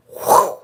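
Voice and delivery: loud breathy whoosh; imitated whoosh. Guessing how the fire video would end, mimed whoosh. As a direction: blow out a sudden whoosh.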